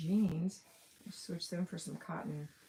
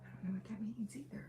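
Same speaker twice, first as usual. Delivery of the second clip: whisper; 4 semitones higher, 8 dB quieter, faster than usual. Real, not acted. Aside to herself while chatting.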